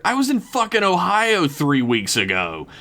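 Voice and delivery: high-pitched indignant bellow. A narrator's reading. Rio giving Jason his alibi, high indignant bellow. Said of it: "Rio bellowed, pointing at Jason".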